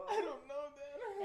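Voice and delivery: high, laughing mock sob; imitating crying. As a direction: whine it like a tearful kid to her dad. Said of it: "I start crying even more".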